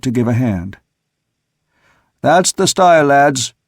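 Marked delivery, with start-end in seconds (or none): 2.2-3.5 a hearty, loud encouraging call. A narrator's reading. Second mate cheering men into the rigging, encouraging. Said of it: "sung out encouragingly".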